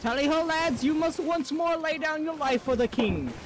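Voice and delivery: high posh English officer call; rally the lads like a pompous captain; tally ho lad voice. Pretend. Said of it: "the Talio lad voice".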